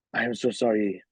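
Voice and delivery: apologetic imitation of a French interviewer; lightly imitated French accent. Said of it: "with the accent that you were doing".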